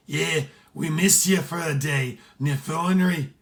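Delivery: put-on hearty Irish sailor accent; heavy Irish accent. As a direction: lay the Irish accent on thick. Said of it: "Sean's accent was so heavy".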